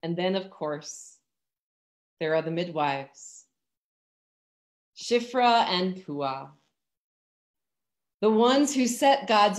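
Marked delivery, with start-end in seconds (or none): none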